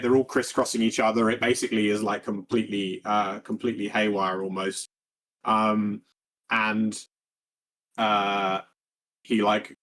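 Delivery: usual speaking voice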